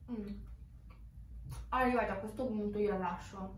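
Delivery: speaking between bites; talking while chewing. Real, not acted. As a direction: mouth partly full. Seen 1: pizza crust held at mouth, biting and chewing throughout.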